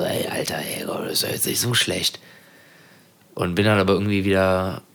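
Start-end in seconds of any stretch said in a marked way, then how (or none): 0.0-2.2 leise, gehauchte Gedankenstimme; flüstert den Gedanken vor sich hin; geflüstert. Retold or imitated. Innerer Gedanke im Traum. (geflüsterter Gedanke)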